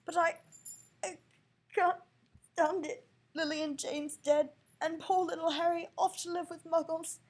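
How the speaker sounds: high, loud sobbing lament; a big man crying high and loud. Acted. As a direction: wail it through tears. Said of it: "sobbed Hagrid".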